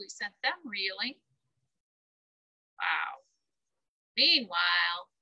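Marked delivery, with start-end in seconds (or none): none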